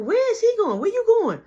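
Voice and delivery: high, loud mock yell across the kitchen; Kiki's shrill call. Kiki voiced shouting in story.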